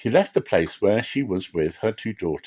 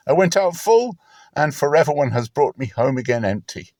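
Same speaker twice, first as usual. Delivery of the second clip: higher, aching lament; bitter Naomi; 4 semitones higher than usual. Acted. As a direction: pour out her bitterness.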